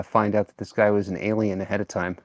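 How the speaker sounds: ordinary talk, nothing marked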